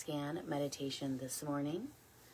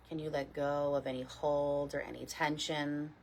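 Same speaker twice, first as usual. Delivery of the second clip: guided meditation. Real, not acted. Teacher leading a morning body scan, calm guided meditation. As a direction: guide them gently, unhurried, letting each phrase settle.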